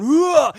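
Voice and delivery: very high, loud grunt of impact; loud cartoon yelp of being hit; mock pain yelp. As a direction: yelp as the kick lands. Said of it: "his back going".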